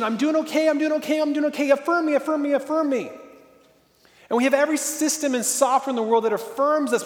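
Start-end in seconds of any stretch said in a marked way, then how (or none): 0.0-3.1 high, begging for approval; a hammed-up plea, repeating it over and over; mock needy pleading. People craving affirmation online, high mock pleading. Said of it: "we want this affirmation".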